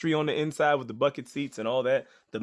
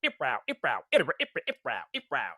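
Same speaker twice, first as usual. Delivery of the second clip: beatbox riff; faster than usual. Retold or imitated. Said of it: "she would go".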